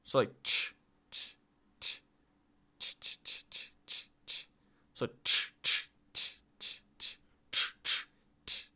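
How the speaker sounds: soft voiceless "ch" and "t" drill, sound by sound. Shown as a demonstration. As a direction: alternate breathy "ch" and "t", voiceless and soft. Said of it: "the C I is like an I".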